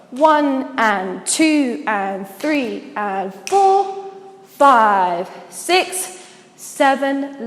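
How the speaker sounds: slow, drawn-out rhythmic count; counting out beats. Shown as a demonstration. Dance teacher recapping with the counts, slow measured counting. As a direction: hold each number for its beat. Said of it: "recap from here quickly with the counts".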